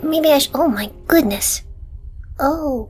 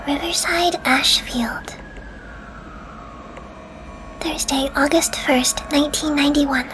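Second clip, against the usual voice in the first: ASMR whisper; 3 semitones higher than usual. Real, not acted. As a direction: whisper close to the mic. Whispers throughout the video.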